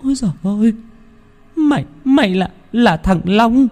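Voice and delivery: put-on high, shrill panicked wail of an old woman; terrified shriek. A narrator's reading. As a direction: scream it out in terror at the returning dead. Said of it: "Âm thanh của bà Quỳnh hét lên thật to". (tiếng thét hoảng loạn của bà Quỳnh)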